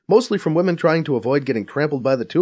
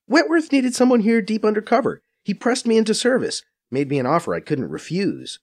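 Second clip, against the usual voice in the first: higher, brisk Faiza voice, a bit scornful; Faiza explaining; 9 semitones higher than usual. A narrator's reading. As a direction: narrator playing Faiza, quick and knowing.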